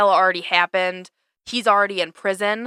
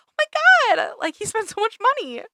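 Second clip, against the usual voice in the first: much higher, louder squealy Michael Scott; Michael impression; 4 dB louder than usual. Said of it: "And Michael goes".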